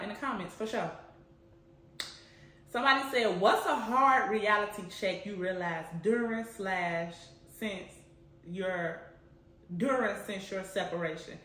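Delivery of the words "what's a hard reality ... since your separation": first read, tripping over words; halting first read. Real, not acted. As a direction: read it haltingly, losing the place. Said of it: "Let me repeat it".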